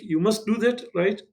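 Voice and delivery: ordinary delivery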